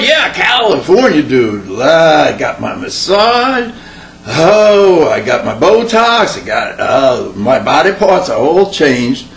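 slow, lazy Southern California drawl, mock pampered; a spoiled Californian bragging lazily; lightly voiced California drawl, slow; California accent. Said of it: "now we're in California".

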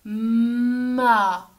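"Ma" with a long held m gliding into a; loud, slow "Ma", each sound sustained; sounds drawn out. Shown as a demonstration. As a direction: stretch "Ma" so both m and a are heard. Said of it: "On entend me et on entend a".

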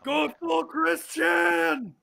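slow drawn-out shout. Real, not acted. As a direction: bellow it slowly to egg him on. Friend egging on, shouting.

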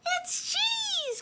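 very high, squeaky mouse voice; tiny falsetto squeak; Mouse's excited cry. A narrator's reading. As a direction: squeal it like a thrilled little mouse. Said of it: "cried Mouse".

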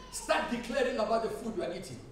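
ordinary talk, nothing marked

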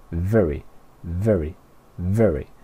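very with clear lip-on-teeth V; V como F. Shown as a demonstration. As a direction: drill very, each opening on an F-like V. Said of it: "es un sonido como una F". (very con V inglesa, palabra por palabra)

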